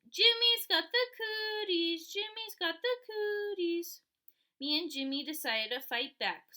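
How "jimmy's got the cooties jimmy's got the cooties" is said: high, loud, slow playground chant; loud high teasing chant, drawn out; singsong yell. A narrator's reading. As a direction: taunt like a schoolgirl on the playground. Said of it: "So she chased Jimmy".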